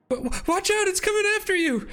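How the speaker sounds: high, urgent stammering dwarf warning; gravelly dwarven voice. Acted. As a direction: shout a frantic warning as a gruff dwarf. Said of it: "a gravelly dwarven voice say".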